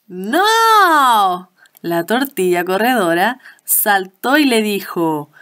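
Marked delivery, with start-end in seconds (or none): 0.1-1.5 a high, loud, startled cry of no; cry of alarm. Acted. Tortilla crying out as the pig eats it, alarmed. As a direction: shriek it as the pig bites down.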